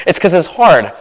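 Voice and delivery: ordinary talk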